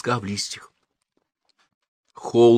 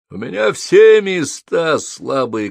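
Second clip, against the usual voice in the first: high, drawn-out exasperated cry; shouting; 7 semitones higher, 3 dB louder, slower than usual. A narrator's reading. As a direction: shout it with raised pitch. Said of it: "крикнул Холл". (крикнуть, высоко и протяжно)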